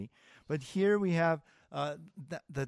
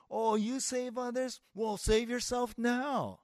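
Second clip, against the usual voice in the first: higher-pitched mocking jeer; 9 semitones higher than usual. Crowd at the cross to Jesus, mocking. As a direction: taunt him in a raised, mock-challenging pitch. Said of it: "they began to mock Christ".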